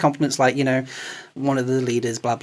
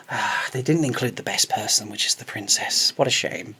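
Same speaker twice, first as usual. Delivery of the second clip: much softer, lower, half-whispered remark; sly hushed aside; 6 semitones lower, 8 dB quieter than usual. Pretend. Group chat suggesting a sly line to the actress, hushed. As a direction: drop a quiet hint to her. Imagined casual line to star.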